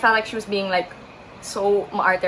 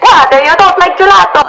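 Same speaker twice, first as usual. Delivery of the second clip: loud, high mock Italian disbelief; incredulous Italian accent; 9 semitones higher, 15 dB louder than usual. Italian stranger shocked she won't take gelato, incredulous Italian accent. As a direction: exclaim in a hammed-up Italian accent. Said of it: "My Italian accent is so bad".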